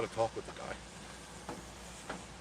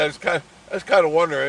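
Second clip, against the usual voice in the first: retold teasing; 6 semitones higher, 7 dB louder, much faster than usual. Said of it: "I said".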